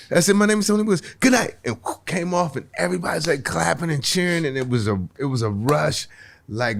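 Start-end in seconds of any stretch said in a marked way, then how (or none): 0.4-1.6 high, called-out goodnight; abrupt stage sign-off. Retold or imitated. Nervous new comic signing off at the light, high call-out. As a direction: call it out to the room, pitched well up.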